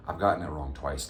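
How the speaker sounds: ordinary talk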